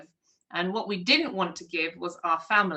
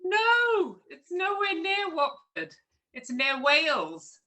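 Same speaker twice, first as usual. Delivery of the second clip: exasperated correction; 8 semitones higher, slower than usual. Retold or imitated. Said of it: "I'm like".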